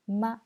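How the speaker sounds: "ma" short, without a glide; neutral tone. Shown as a demonstration. Teacher reviewing the tones, "ma" short and neutral. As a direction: say "ma" briefly and unstressed.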